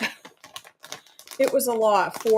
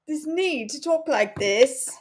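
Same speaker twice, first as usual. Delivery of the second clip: light mock British accent; playful fake British accent; woke up British; 4 semitones higher than usual. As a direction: put on a posh-ish British lilt for fun. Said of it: "I woke up British".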